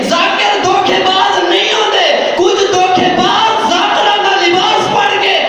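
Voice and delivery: high, sustained shouted sermon; fiery preaching. Acted. Preacher exposing frauds posing as zakirs, fiery preaching. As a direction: drive the point home to the crowd.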